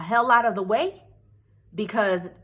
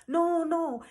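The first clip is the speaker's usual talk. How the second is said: pleading officer; 6 semitones higher than usual. Retold or imitated. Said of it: "He was like".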